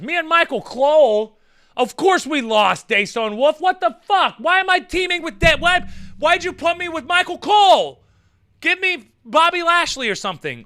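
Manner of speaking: loud, high indignant rant; mock outrage. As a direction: play up outrage at a terrible pairing. Indignant at fantasy tag partner.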